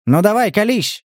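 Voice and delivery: urging. A narrator's reading. Stas pushing the giant to confess, urging. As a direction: press him to spill it. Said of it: "поторопил его Стас".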